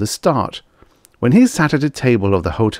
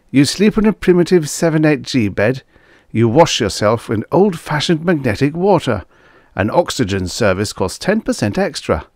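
higher-pitched grumbling trucker; complaining; 7 semitones higher than usual. A narrator's reading. Trucker griping about a primitive hotel, complaining. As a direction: list the grievances in a raised voice. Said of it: "Brack shakes his head complainingly".

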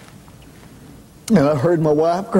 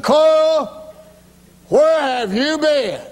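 preacher calling; 11 semitones higher, much slower than usual. Retold or imitated. Preacher calling out in retold scene.

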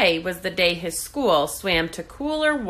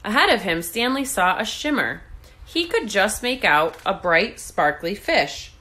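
ordinary delivery, nothing marked

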